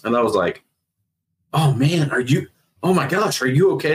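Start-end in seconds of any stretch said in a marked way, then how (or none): 1.5-3.9 high-pitched, stumbling dismay; acted-out alarmed worry for a friend; retold shocked concern. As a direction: break off and restart, voice raised in pitch. Reacting to friend's injury in story.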